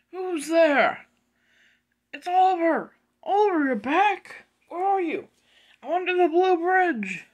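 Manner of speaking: high, loud calls; calling out. Acted. Toby and Oliver finding each other by the bridge, calling out. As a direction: shout across to someone out of sight.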